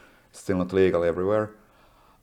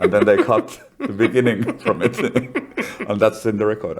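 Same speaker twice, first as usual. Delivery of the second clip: laughing through the words; amused, laughter spilling into the line; 3 semitones higher, 4 dB louder than usual. Real, not acted. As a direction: chuckle as you tell it. Laughing while retelling the recording.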